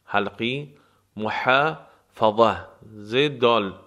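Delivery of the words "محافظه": محافظه drawn out very slowly, throaty ح and a full, heavy ظ; very slow pronunciation. Shown as a demonstration. Teacher demonstrating the heavy ظ in محافظه, very slow. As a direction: stretch محافظه syllable by syllable, heavy ظ.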